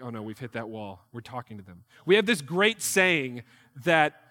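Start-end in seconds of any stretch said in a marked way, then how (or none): 0.0-1.8 much lower and hushed, a mock panicked aside; understated comic dread; mock alarm. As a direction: hush it like you've hit a wall. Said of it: "we as Americans are like".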